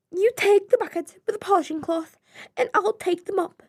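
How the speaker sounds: high, quick, haughty posh fish; snooty upper-class servant. Acted. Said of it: "in a posh voice".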